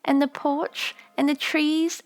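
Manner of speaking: put-on a young daughter longing for home; little girl remembering. A narrator's reading. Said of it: "daughter's long remembered speech".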